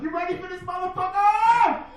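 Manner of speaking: high, hyped-up crowd call; hype announcer. Real, not acted. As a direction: pump the crowd up, shouting high. MC hyping crowd for comedian.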